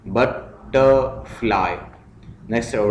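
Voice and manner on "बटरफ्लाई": बटरफ्लाई slowly in three beats, बट, टर, fly; split into syllables. Shown as a demonstration. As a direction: pause between each syllable of बटरफ्लाई. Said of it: "syllables में divide करना है".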